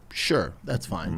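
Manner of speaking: low, soft, slow acceptance; understated approval. Retold or imitated. Dad answers the kids' lesson, understated. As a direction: let it drop quietly and slowly.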